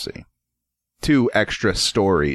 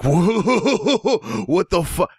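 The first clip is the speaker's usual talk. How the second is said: much higher, laughing disbelief; guy's laughing voice; 11 semitones higher, much slower than usual. Retold or imitated. A customer laughing at the name, high and amused. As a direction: squeal it out like a guy cracking up. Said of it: "I'm doing his voice".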